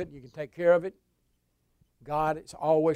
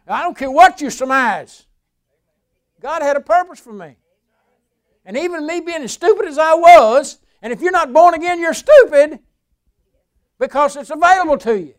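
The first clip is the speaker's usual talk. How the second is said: high, loud preacher's shout; loud fiery pulpit shout; preaching at full force; 11 semitones higher, 18 dB louder than usual. Real, not acted. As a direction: rail at the doubters from the pulpit.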